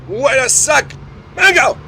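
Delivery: high, loud exasperated call of a name; calling partner out, loud and high; sharp call. Acted. Catching partner faking binoculars.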